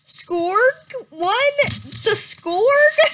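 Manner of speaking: can't believe the name she just heard; shocked gasp. Acted.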